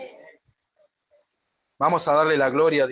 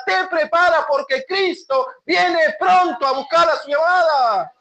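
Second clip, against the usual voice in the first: preaching at full force; 6 semitones higher than usual. Acted. Pastor preaching about the second coming, shouting. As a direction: build to a fiery climax about his return.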